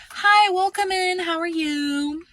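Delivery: high, drawn-out customer-service singsong; cheery store greeting. Retold or imitated. Store worker welcoming a customer who walked in, cheery singsong.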